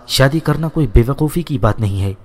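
usual speaking voice

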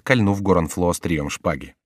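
ordinary delivery

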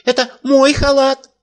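high, loud shout; old man shouting. A narrator's reading. Old man shouting his claim before the emir, high. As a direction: fling it out high. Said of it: "закричал старик".

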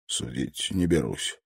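usual speaking voice

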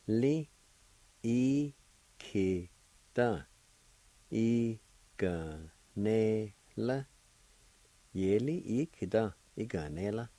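"ye, li, i, ki, da" each syllable apart, then joined. Shown as a demonstration. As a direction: teach the syllables slowly and clearly. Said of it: "First part is pronounced like this".

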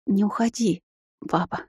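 soft, careful request; cautious. A narrator's reading. Daughter gently stops her flustered father, cautious. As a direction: tentatively ask him to stay, softly. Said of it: "осторожно произнесла я".

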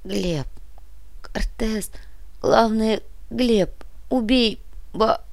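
weak groaning half-whisper; half moan, half whisper. A narrator's reading. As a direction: gasp the words out, almost voiceless. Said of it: "полустон, полушёпот комиссара".

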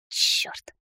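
breathy whispered swear; whispered curse. Acted. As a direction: hiss the curse under the breath. Mage swears under breath.

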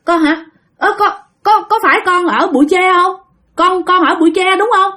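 high, stammering questions of recognition; dumbfounded. Acted. Aunt Bảy realizes her lost baby is speaking, stunned. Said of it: "dì Bảy mới ngớ người".

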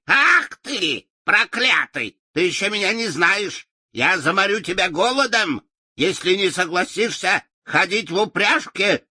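put-on high, furious old witch cry; shouting witch. A narrator's reading. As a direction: scream at him through the bars. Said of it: "она крикнула через прутья решётки". (злобный крик ведьмы)

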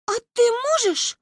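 put-on very high child voice; high, eager child's question; hopeful little girl. A narrator's reading. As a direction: ask in wide-eyed childish wonder. Child hopefully asks grandmother.

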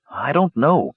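thoughtful. A narrator's reading. Palin weighs his brother's guess about the jungle, thoughtfully. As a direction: say it as if still thinking it over. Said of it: "Palin said thoughtfully".

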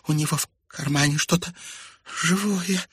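high, halting scared voice; timid, high-pitched alarm; frightened. A narrator's reading. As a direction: hesitate before the last word. Frightened girl, same stammering speech.

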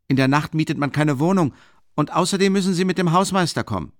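high, firm workman's voice; objecting workman. Acted.

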